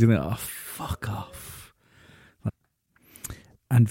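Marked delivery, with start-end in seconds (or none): 0.2-1.7 slow, hushed mock dismissal; whispered brush-off from the gym guys. Their imagined dismissive reply.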